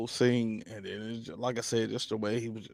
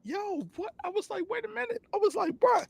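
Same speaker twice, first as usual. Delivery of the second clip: squeaky, much higher, shocked; high-pitched disbelief; faster than usual. Retold or imitated.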